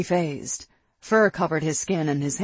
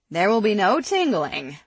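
raised, forceful mild growl; loud, higher-pitched warning; 6 semitones higher, 5 dB louder than usual. A narrator's reading. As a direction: lay down the law with a light growl. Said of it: "El growled".

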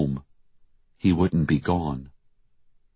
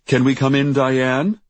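raised, louder call ending in a rise; reporter behind door; 9 semitones higher, 6 dB louder than usual. A narrator's reading. Reporter asking Diane to let him in, calling. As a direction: call through the door, pitch up. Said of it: "said the voice behind the door".

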